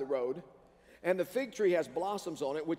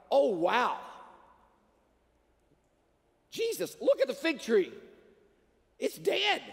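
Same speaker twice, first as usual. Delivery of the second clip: amazed disciples; 7 semitones higher, 3 dB louder than usual. Retold or imitated. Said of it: "the disciples are going like".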